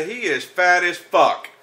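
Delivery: normal talking voice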